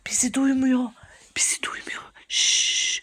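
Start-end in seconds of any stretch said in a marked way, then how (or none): 1.4-2.0 soft, voiceless whisper. Pretend. Hiding, must not be heard. 2.3-3.0 soft "shh"; hushing shush. Pretend. Hushing partner while hiding.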